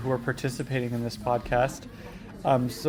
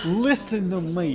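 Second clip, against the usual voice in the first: slow, higher put-on actor voice; amateur comic actor impression; Danny DeVito; 4 semitones higher, slower than usual. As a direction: draw it out in a character voice. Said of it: "I'm trying to do Danny DeVito's voice".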